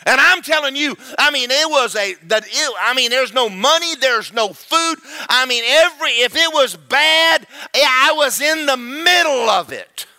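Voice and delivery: high, loud, urgent testimony; impassioned pulpit outpouring; full-force preaching. Real, not acted. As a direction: press each broken phrase harder, building.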